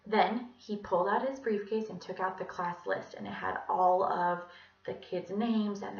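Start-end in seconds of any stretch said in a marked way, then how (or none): none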